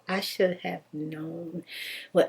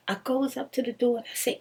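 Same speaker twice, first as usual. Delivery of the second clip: normal talking voice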